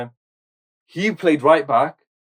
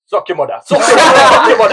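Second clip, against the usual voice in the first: raised, louder voice on the repeats, half laughing; mock team talk; 7 semitones higher, 8 dB louder, faster than usual. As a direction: build a mock dressing-room rant through laughter. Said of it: "And he said it like that".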